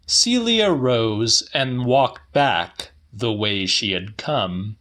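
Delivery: plain reading voice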